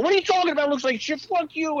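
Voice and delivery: high, brash put-on yell back; mock yelling. Light guy yelling back at the crew, mock loud. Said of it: "they're yelling at each other".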